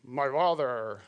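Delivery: hammed-up put-on brother's voice, drawn out; best Esau voice. Son posing as his brother to his blind father, hammed-up disguised voice. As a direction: put on a disguised voice, stretching the words. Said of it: "in his best Esau voice".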